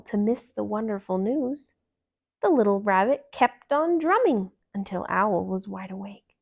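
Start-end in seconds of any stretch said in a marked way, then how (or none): none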